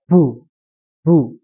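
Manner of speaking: Bu said cleanly without extra breath; a simple, normal Bu; plain correct bu. Shown as a demonstration. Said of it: "Jadi biasa saja". (Bu dibaca biasa tanpa hembusan)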